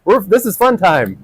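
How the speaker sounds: put-on a light touch of a delighted five-year-old, squealing high on ooh; mildly voiced kid bursting with surprise and glee; excited little kid. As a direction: play a child thrilled to be praised. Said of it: "five-year-old kid here who's like".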